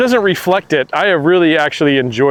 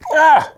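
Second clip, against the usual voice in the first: frustrated yelp; 11 semitones higher than usual. Real, not acted. Reacting to bad shot.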